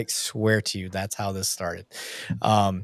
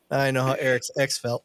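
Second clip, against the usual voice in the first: ordinary talk, nothing marked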